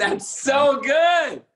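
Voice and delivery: high, slow, drawn-out cry of delight; delighted shout. Real, not acted. [name] loudly thrilled by the host's accent, delighted shout.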